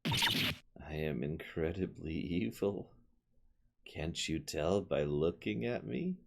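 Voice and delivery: lightly voiced slow soft villain. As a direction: menace them softly and slowly. Villain speaks slowly.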